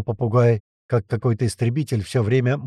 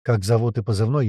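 usual speaking voice

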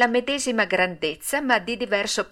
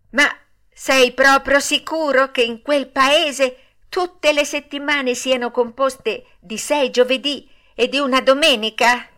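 put-on wondering puppet boy; 5 semitones higher, 4 dB louder than usual. A narrator's reading. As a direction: ask in a raised, wide-eyed boyish voice.

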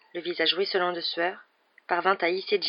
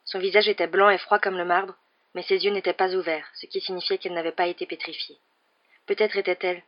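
ordinary delivery, nothing marked

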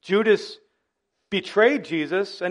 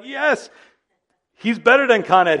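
higher, louder cheering relief; excited cheer; 6 semitones higher, 7 dB louder than usual. Pretend. Congregant cheering when power returns, excited. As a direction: cheer like the power came back. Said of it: "you're like".

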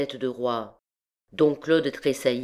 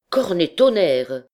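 loud oath; 4 semitones higher, 7 dB louder than usual. A narrator's reading.

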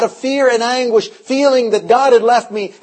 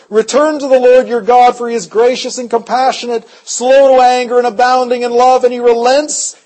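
forceful preaching; 3 semitones higher than usual. Acted. Preacher urging the church to return, impassioned. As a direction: proclaim it to the congregation.

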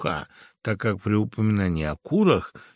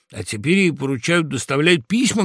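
indignant, slightly raised and louder; heated; 5 semitones higher, 5 dB louder than usual. A narrator's reading. Woodpecker arguing with rooster about fox, heated. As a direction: argue hotly, mild outrage. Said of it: "горячился дятел".

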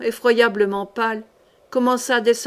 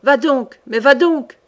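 louder, urgent cry; 3 semitones higher, 5 dB louder, slower than usual. A narrator's reading. Queen urges Boisredon down the stairs, shouting. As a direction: push him on with a shout. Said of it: "elle crut les crier".

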